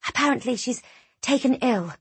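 high, hushed gossip; whispered. A narrator's reading. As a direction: pass on the rumour under the breath. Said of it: "a young acolyte whispered to her companion".

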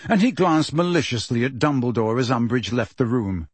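ordinary delivery

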